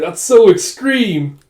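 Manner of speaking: acted amazed amusement; amused exclamation. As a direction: exclaim it slowly, high and loud, as if tickled. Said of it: "And Jaeha's just laughing".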